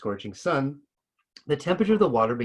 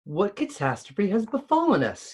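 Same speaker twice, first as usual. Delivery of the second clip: higher, alarmed cry of the pond spirit; cried aloud; 9 semitones higher than usual. A narrator's reading. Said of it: "he cried aloud".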